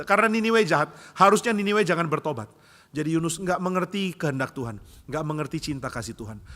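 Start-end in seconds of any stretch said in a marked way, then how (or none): 0.0-2.5 raised, loud self-justification; acted angry insistence; indignant. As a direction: argue your grudge hotly. Angry Jonah justifies himself.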